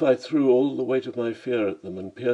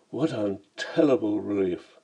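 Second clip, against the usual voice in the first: plain reading voice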